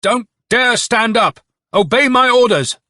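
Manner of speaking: high, loud, commanding yell; piercing shout. A narrator's reading. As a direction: shout a desperate order at the troops. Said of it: "Then he gave a piercing shout".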